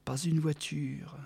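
slow, breathy whisper. A narrator's reading. Narrator describing the silent street, whispered. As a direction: let it fade into a whisper.

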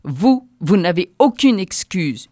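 loud, slow furious roar; yelling. A narrator's reading. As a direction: roar it down at her. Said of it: "hurla l'obèse".

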